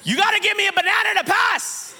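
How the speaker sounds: high, forceful mock baboon voice; hammed-up animal bully; baboon toll-keeper. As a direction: demand it as a pushy baboon. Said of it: "The baboons were like".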